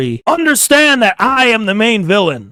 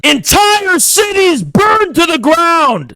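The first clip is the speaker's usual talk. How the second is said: higher, slow shouted proclamation; slow proclaiming shout; 8 semitones higher, slower than usual. Real, not acted. Proclaiming his revolution to listeners, slow shout. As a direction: announce the burning like a conqueror.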